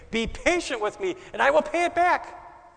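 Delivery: a desperate servant begging on his knees. A narrator's reading. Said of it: "fell on his knees and begged him".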